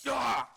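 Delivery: loud high yelp; sudden pained shout; sharp cry of pain. Acted. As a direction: cry out as the shot hits. Judge shot mid-call.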